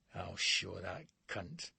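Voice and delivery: soft, slow hiss through the teeth. A narrator's reading. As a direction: hiss the threat low and slow. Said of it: "I hiss at Bladesy".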